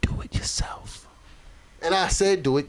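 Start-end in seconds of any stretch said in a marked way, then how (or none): 0.0-1.1 whispered, drawn-out retort to the team; hushed coach. Retold or imitated. Coach tells the team to run it themselves, whispered. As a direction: whisper it slowly, like a coach giving up.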